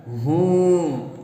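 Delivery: drawn-out groan of pain; slow, pained hmm; sick husband groaning in bed; groaning hum. Acted. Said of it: "کراہتا ہے".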